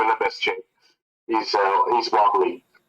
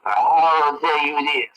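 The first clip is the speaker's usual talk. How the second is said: slow dramatic; 4 semitones higher, much slower than usual. Acted. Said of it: "And Trick goes".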